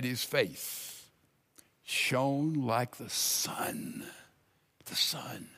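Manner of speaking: slow, breathy near-whisper; hushed awe. Retold or imitated. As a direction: let it fade into an awed whisper. Awed retelling of shining face.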